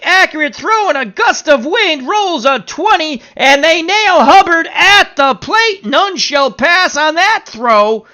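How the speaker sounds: high, loud baseball call erupting into a shout; excited play-by-play. Pretend. Announcer calls the throw nailing Hubbard at the plate, excited play-by-play. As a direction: call the big play at full pitch and volume.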